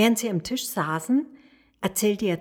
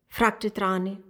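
usual speaking voice

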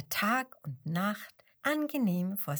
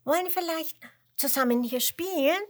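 eager invitation; 8 semitones higher, 5 dB louder, faster than usual. A narrator's reading. Penguin invites lion to play.

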